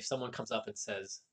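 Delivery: normal talking voice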